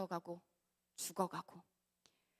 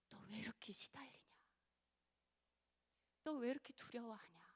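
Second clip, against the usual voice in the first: soft whisper, rising at the end; hushed gentle whisper of the question; 5 dB quieter than usual. Retold or imitated. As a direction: whisper it tenderly.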